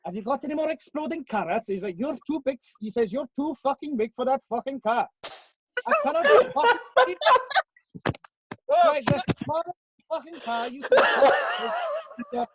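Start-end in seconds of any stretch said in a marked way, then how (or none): 0.0-1.7 higher, put-on Welsh accent; mock Welsh accent. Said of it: "so I'll do a Welsh one instead". 2.0-2.7 higher, put-on Welsh lilt; mock Welsh accent. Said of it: "so I'll do a Welsh one instead". 3.1-12.5 high, hammed-up Welsh accent breaking into laughter; mock Welsh accent. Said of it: "so I'll do a Welsh one instead".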